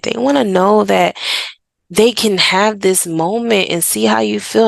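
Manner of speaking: usual speaking voice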